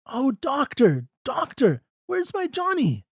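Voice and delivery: high, anxious crying out to the doctor; a mother desperate at the door; a desperate mother begging, pitched high; frantic plea. A narrator's reading. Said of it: "She lifts the knocker".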